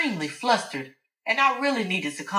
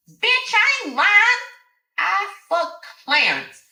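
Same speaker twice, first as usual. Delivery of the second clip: defiant Alice; 12 semitones higher, 4 dB louder than usual. Acted. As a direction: throw it in her face. Alice insisting she isn't lying.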